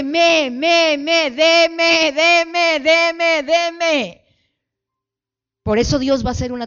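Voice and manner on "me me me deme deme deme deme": loud, high, repeated mock bleats; bleating sheep. Demanding sheep pestering the pastor, repeated bleating. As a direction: bleat insistently, each one high and loud. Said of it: "la ovejita dice".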